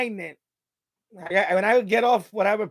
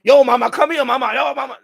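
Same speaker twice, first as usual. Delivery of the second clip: loud, higher catcalling mock friend; imitating pushy friends; 4 semitones higher, 7 dB louder than usual. Friends hollering at a woman, mock catcall. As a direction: call out like a pushy guy at a club. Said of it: "they would be like".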